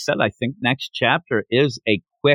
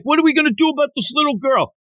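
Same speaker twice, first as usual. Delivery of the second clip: mock thug voice; 12 semitones higher, much faster than usual. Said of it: "'Cause they're like".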